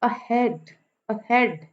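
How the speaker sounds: Ahead with light अ and strong हेड; iambic stress. Shown as a demonstration. Teacher gives iambic example, हेड stressed in ahead. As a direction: stress the second syllable of ahead. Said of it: "हेड पे stress है".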